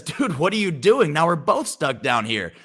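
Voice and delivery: as if your friend just jumped into the hole with you; trapped man gasping at his friend's jump; stunned disbelief. Retold or imitated.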